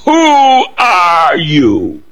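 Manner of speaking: high, slow and drawn-out Who are you; Caterpillar voice. Retold or imitated. Quoting Alice in Wonderland to the congregation, in a high drawn-out voice. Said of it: "That's Alice in Wonderland".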